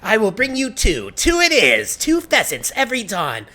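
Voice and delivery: high, loud, overeager vow; frantic eagerness. Acted. Villager promises two pheasants every dawn, frantically eager. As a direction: proclaim it high as if sealing a bargain.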